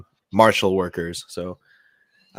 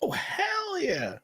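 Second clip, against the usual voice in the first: high, slow, drawn-out cheer; hyped drawn-out yes; excited agreement; 8 dB quieter, much slower than usual. Real, not acted. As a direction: stretch it out in delight. Enthusiastic agreement.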